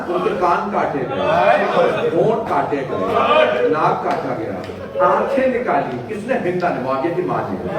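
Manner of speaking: high, loud mourning recitation; impassioned majlis lament. Acted. Preacher recounting Uhud to mourners, full-force wailing lament. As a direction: preach the martyrdom like a rising cry.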